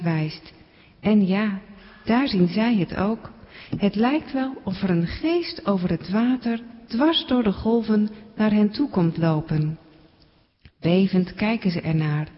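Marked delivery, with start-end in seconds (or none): none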